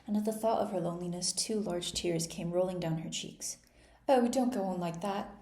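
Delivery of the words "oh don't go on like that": a louder, flustered plea; distressed queen pleading with a crying girl; despairing cry. A narrator's reading. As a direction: beg her to stop, hands wrung in despair. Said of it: "cried the poor queen, wringing her hands in despair".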